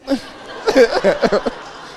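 high laughter trailing down; real laugh. Real, not acted. Moving to the next slide still laughing, high giggle. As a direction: keep giggling.

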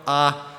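flat level ah, held even; ah held on one steady level pitch; first tone. Shown as a demonstration. Teaching the class Mandarin's first tone, level. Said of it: "the first one is a flat tone".

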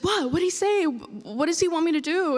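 high, pressing questions; anxious mom. Retold or imitated. Mom pressing her daughter about the call, high and anxious. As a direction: fire off worried questions like mom.

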